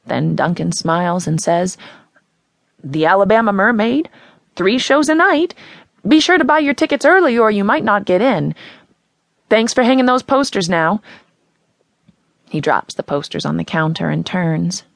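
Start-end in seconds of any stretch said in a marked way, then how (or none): 2.8-11.0 a hint of a friendly Midwestern accent, higher and louder; a lightly voiced carnival man's friendly Midwestern accent. A narrator's reading. Carnival man charming the townies, friendly Midwestern accent. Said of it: "The friendly Midwestern accent is gone".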